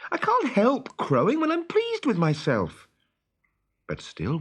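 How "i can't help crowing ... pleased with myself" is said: put-on high, louder, begging boy's voice; pleading. A narrator's reading. Peter begging Wendy not to leave him, high loud pleading. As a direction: beg her not to go.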